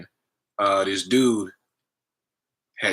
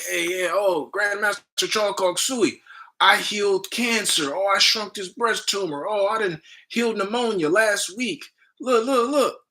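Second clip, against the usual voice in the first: very high, hammed-up showing off; eager boasting; 10 semitones higher than usual. Student brags of healings to the grandmaster, excited and high-pitched. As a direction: play an overeager pupil begging to be noticed. Imagines himself boasting to the master.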